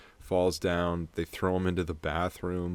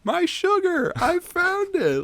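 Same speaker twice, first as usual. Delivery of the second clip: much higher squealing exclamation, slowed at the end; mocking the character; 7 dB louder, slower than usual. Imitating sister talking to camera, high squealy mock. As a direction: ham up the girl's overacted discovery. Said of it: "She looks right at the camera and goes".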